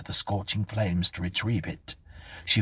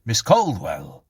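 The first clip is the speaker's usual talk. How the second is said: louder, slow call with a falling end; insistent call; 3 semitones higher, 6 dB louder, slower than usual. A narrator's reading. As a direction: call her name firmly to rouse her. Policeman calls her again, firmer.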